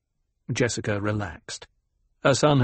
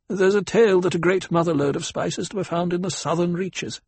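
Kynes; 6 semitones higher than usual. Acted. Kynes explains the desert.

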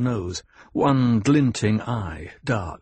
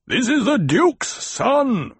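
high, slow, forceful bark; commanding bark with raised pitch; barked retort; 13 semitones higher, 4 dB louder, slower than usual. A narrator's reading. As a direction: bark it up to someone above. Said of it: "Stilgar barked".

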